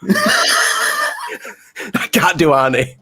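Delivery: giggling through the words; laughing. Real, not acted. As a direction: laugh while you say it.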